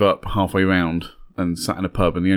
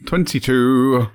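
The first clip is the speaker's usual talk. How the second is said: slow drawn-out bingo call; bingo caller; 4 semitones higher, 4 dB louder, much slower than usual. Pretend.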